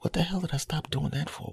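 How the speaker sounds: high, soft self-questioning; puzzled at himself, half to himself; rueful disbelief. Retold or imitated. Asking himself why he quit, soft rueful disbelief. Regretting quitting something loved.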